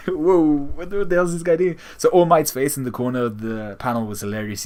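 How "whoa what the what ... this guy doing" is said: higher, quick startled disbelief; shocked reaction. Retold or imitated. Fan reacting to Endeavor in disbelief. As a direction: react like you can't believe what you're seeing. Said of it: "And I was like".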